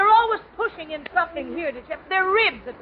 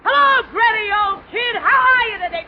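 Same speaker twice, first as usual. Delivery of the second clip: loud higher greeting called down the hall; retold yell; 5 semitones higher, 6 dB louder than usual. Running past Garbo's door, yelling a loud cheeky hello. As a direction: holler it cheerfully while running past. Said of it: "I'd yell out".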